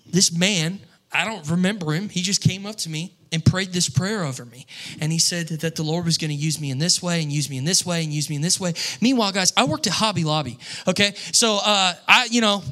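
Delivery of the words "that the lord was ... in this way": ordinary talk, nothing marked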